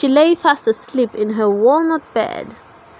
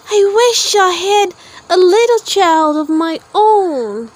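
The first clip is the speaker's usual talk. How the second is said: a touch higher, wistful wish; lonely woman; 6 semitones higher than usual. A narrator's reading. Lonely woman wishing aloud in her garden, wistful. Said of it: "the woman said out loud".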